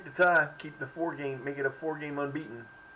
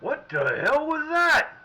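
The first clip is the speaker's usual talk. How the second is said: outraged disbelief; 11 semitones higher, 6 dB louder, much slower than usual. Real, not acted. Outraged at a bad play.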